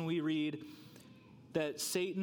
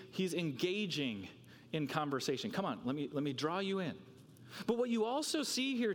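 ordinary talk, nothing marked

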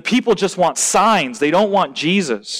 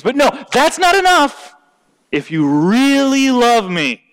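mock demanding; 9 semitones higher, 4 dB louder than usual. Preacher voices boyfriend pressuring the girl, mock-insistent.

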